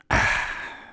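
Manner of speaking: a breathy satisfied ahh after a gulp; satisfied sigh. A narrator's reading. Father and son after a big swallow, satisfied sigh. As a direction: let out a refreshed ahh. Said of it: "we both responded simultaneously".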